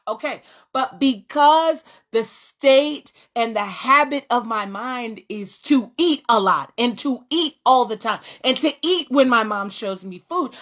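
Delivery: ordinary talk, nothing marked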